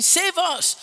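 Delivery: high acted cry for help; pleading cry. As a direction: call out like a crowd begging. Acting out crowd crying to Jesus.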